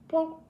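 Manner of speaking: quick little mouth pop; imitated plop. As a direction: make a plopping sound effect. Imitates next grape popped in.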